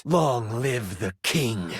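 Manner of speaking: low, slow, deliberate pronouncement; vengeful prince. Acted. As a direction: deliver it low and slow as a parting blow. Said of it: "The prince locks eyes with his father and says".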